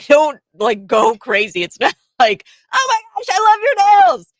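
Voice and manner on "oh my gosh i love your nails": squealed very high and loud; a mock shriek of delight; mock squealing fan. Mocking how the man reacted to nails, squealing very high. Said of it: "That's not how we react".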